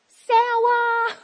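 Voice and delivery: high, very loud drawn-out shout; kids calling out. Retold or imitated. Kids on the bus when ignored, imitated loud high shout. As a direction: belt it out like a child jeering. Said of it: "they wave".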